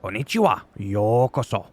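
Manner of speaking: put-on Japanese accent; a brief Japanese-accented impression; Shinsuke Nakamura. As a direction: imitate a Japanese wrestler's greeting. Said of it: "Shinsuke Nakamura and I try to do".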